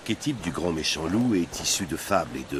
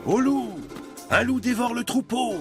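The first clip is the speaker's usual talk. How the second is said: crying wolf. Acted. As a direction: shout it out as a prankster boy. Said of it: "s'amusa-t-il à crier un jour".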